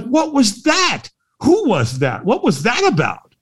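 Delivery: high, drawn-out baffled "what"; retold bewilderment. Walker after a mystery man vanishes, bewildered. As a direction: replay being baffled, pitch leaping up.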